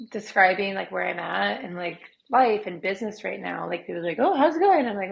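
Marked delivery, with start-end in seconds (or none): 4.2-4.9 higher, louder imitated greeting; chirpy small talk. People asking about business, imitated bright small talk. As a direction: mimic an upbeat, casual check-in.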